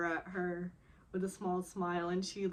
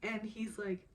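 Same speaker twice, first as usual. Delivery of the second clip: usual speaking voice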